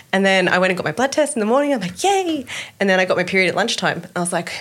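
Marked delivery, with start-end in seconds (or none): 2.0-2.4 high squeaky yay; a sudden high little yay; mock cheer. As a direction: chirp it up high like a hopeful kid. Retold hopeful cheer.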